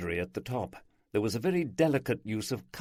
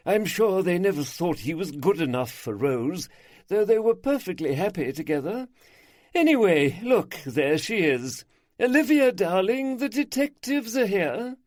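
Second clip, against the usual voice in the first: a little higher, chatty and confiding; a hint of a lively older lady; lightly voiced gossiping hostess; 10 semitones higher, 5 dB louder than usual. A narrator's reading. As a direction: gossip cheerfully about the neighbours. Isabel speaking in the story.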